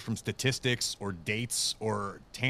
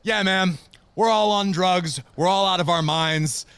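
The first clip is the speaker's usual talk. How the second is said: loud, high mock agreement; loud sarcastic retort, higher; 6 semitones higher, 7 dB louder, slower than usual. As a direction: snap back sarcastically at the caller. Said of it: "where I went".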